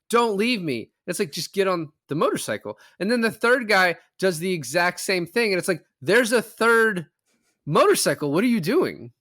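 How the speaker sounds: normal talking voice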